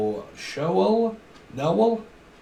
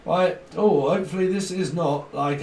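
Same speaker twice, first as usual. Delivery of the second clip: ordinary delivery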